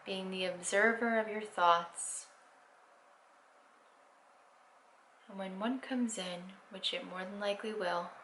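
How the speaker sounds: guided meditation. Acted. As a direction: ease them into stillness, unhurried.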